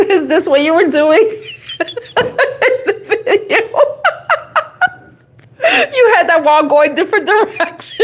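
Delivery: high, laughing "oh my God". Real, not acted. Laughing at the reading with viewers. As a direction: crack up as you say it. Laughing fit at a funny card.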